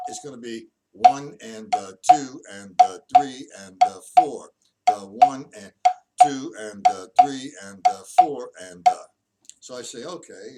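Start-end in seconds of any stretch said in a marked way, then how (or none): 0.9-9.0 loud, lilting swung count over the drums. Shown as a demonstration. Drum teacher shows the student the count swung. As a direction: swing the count, long-short on each beat. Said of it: "and I swing that".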